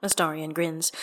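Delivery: plain reading voice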